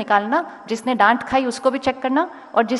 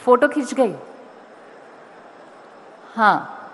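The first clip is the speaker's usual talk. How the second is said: calling out to crowd; 2 semitones higher, 5 dB louder than usual. Real, not acted. Urging students to raise hands.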